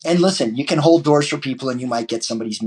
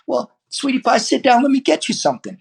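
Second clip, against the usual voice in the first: much higher mock waitress voice; sweet waitress; 13 semitones higher than usual. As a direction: play a doting diner waitress, pitched way up. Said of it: "You walk into a restaurant and say".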